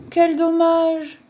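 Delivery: slow, high drawn-out lament; sighed regret. A narrator's reading. Wolf forced to leave his dance partner, sighing. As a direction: sigh it out with regret. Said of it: "soupira Loup".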